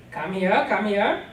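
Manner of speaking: put-on stern aunt beckoning a reluctant child; commanding call. A narrator's reading. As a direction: order the child over to you. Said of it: "her command".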